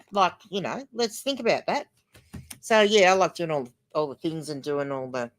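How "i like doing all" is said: normal talking voice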